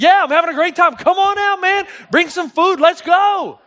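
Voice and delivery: excited call to join in; cheery shout. Retold or imitated. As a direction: holler back from the pool, totally at ease. Shouting back cheerfully from the pool.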